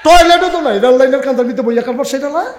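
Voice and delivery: high, loud, fast ridicule; mocking shout. Real, not acted. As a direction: jeer at them with a laugh. Mocking India's lack of toilets.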